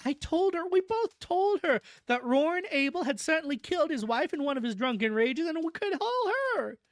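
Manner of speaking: high-pitched wail of despair; moaning despair. A narrator's reading. Cousin recounting her warnings to family, despairing wail. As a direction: wail the complaint like a distraught cousin. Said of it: "took up the refrain between her moans of despair".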